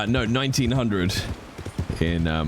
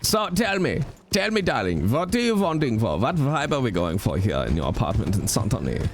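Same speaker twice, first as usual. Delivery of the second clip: put-on German accent; 3 semitones higher than usual. Franz salesman pitch.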